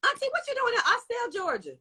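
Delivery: high, fast squeal at a viewer; teasing auntie voice. Pretend. Teasing a viewer from Austell Georgia in a high squeal. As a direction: tease her like a nosy auntie.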